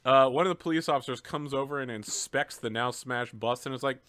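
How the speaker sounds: ordinary delivery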